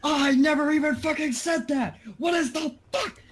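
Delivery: strained high whisper-shout; whisper yelling. Acted. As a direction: yell in a harsh whisper. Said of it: "I can't do whisper yelling".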